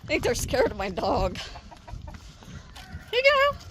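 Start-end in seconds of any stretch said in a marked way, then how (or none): 3.1-3.6 calling chickens. Real, not acted.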